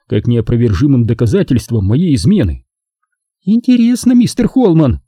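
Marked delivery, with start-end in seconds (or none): 3.4-5.0 high, coldly formal woman's voice; icy. A narrator's reading. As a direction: question him with frosty politeness. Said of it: "ледяным голосом произнесла она". (ледяным голосом)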